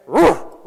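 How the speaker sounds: sudden loud imitated snort; lion's sneezing snort. Leo snapping his head round at the men, explosive snort. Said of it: "The lion basically sneezed at us".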